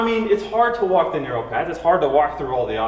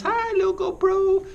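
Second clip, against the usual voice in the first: put-on cute baby talk; 13 semitones higher than usual. Greeting the camera in his hands.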